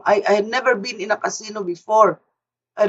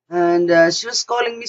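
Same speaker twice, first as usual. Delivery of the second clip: ordinary talk, nothing marked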